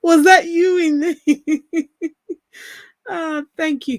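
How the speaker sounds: giggling disbelief at a viewer; laughing. Real, not acted. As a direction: ask while cracking up. Laughing at a chat name.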